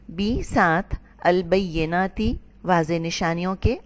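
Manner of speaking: ordinary delivery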